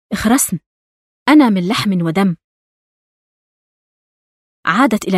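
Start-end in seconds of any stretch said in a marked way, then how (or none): none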